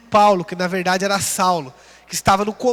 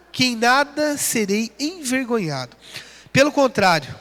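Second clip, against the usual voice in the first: ordinary talk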